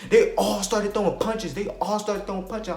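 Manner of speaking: ordinary talk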